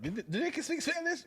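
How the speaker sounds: high-pitched quoted question; mock surprise. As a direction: squeak it out like a baffled onlooker. Imagined reaction of listeners, mimicked.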